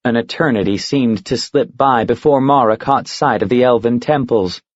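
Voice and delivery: plain reading voice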